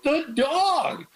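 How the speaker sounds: high, eager exclamation; excited. Retold or imitated. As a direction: point at the dog in amazement. Retelling his own excited reaction.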